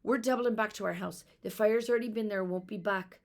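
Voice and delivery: big sister urgently leading her sister home. Acted. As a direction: push it out fast with urgency. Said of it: "Fiona answered urgently".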